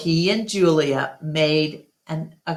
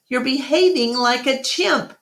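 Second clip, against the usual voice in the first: teasing voice; 8 semitones higher than usual. A narrator's reading. Bob scolds Ivan dancing.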